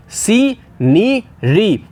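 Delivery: Scenery stretched very slowly as सी-नि-री; slow sounded out. Shown as a demonstration. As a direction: draw out scenery, each syllable clear. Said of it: "spelling भी बहुत ध्यान से इसकी देखनी है".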